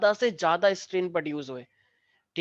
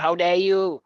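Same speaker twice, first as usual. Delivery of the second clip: mock outrage; 5 dB louder, much slower than usual. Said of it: "वो कह रही".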